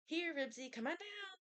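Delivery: high pleading call to the dog; boy begging his dog off the ladder. A narrator's reading. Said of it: "begged Henry".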